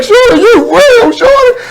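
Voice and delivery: high, slow, tearful imitation of a tough guy; imitating a hard-time criminal crying on the phone at the speech; mock crying. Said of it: "crying on the phone".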